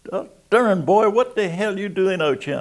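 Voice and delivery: high, loud surprised bark; a blustering commander shouting at an old friend; imitating the commander. Commander greets old friend with a loud surprised holler.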